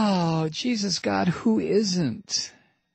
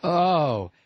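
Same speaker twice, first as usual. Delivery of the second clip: ordinary delivery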